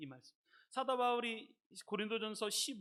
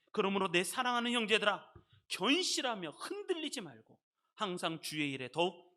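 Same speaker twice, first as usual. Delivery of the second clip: declaring scripture; 6 dB louder than usual. Real, not acted. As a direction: proclaim it firmly to the brethren. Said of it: "선포하듯이 이 말씀을". (힘주어 선포하듯)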